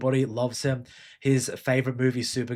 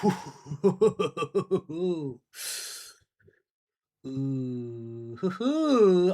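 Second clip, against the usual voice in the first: giggly laugh; much slower than usual. Real, not acted. Laughing while shopping on Steam.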